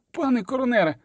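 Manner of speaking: a high, frantic call to the coroner; panicked growl. A narrator's reading. A terrified mercenary calls to his coroner, panicked. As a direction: blurt it out in panic. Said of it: "вигарчав один".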